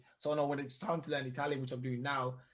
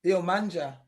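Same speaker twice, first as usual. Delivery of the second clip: hammed Italian; 2 semitones higher, 5 dB louder than usual. Shown as a demonstration. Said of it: "you sound like a".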